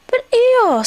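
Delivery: high, loud, pleading cry; distressed. A narrator's reading. Pooh to floating Eeyore, in distress. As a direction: call the name in dismay. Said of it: "said Pooh in distress".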